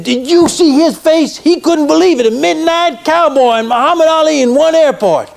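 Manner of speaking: high-pitched retold excitement; delighted marvelling. Boxer tells actor about the stunned fan, delighted marvelling. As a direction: marvel aloud, voice lifted well up. Ali delighted at the fan's amazement.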